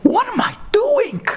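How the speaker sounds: very high, incredulous cry; shocked self-questioning. Retold or imitated. Monk in monastery questions himself, high shocked exclamation.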